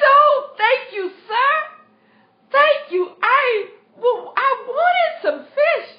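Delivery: high, loud, tearful cry of thanks; overjoyed thanks. Acted. Hungry lad thanks the fisherman for the fish, crying out high and tearful. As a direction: burst out thanking him, almost in tears, high and loud.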